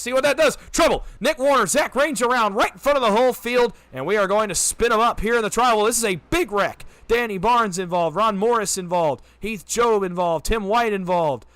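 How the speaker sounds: rising pitch, loud urgent call; excited race call. Real, not acted. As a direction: shout the wreck as it happens. Wreck erupts during race call.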